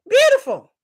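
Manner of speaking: high loud drawn beautiful; emphatic high. Real, not acted. Praising Egyptian women, high loud emphatic.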